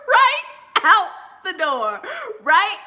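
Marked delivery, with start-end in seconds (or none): 0.1-0.4 high shrieking laugh. Real, not acted. Comedian reliving the fall out the door, shrieking laugh. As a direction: let the laugh fly up into a squeal. Slipping on rose petals.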